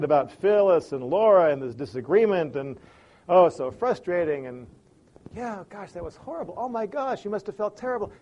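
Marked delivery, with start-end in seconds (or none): 5.3-8.1 soft, sympathetic murmurs; soothing a frustrated friend softly; gentle empathy. Pretend. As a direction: comfort her quietly. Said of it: "a more gentle way to do it".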